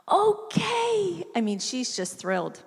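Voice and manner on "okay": put-on high, loud little-girl "okay"; excited toddler. A parent imitates her toddler's thrilled okay. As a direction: squeal it like a thrilled two-year-old. Toddler answering mom's present prompt.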